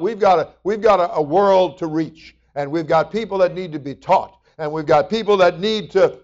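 ordinary talk, nothing marked

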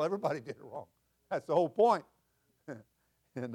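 usual speaking voice

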